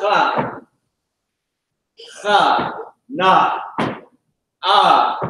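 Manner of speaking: KHA, KHA, NA, AH one at a time, each drawn out; demonstrated syllables. Shown as a demonstration. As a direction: sound out each syllable with an arched pitch and a pause after. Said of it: "You got kha".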